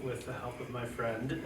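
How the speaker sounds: ordinary talk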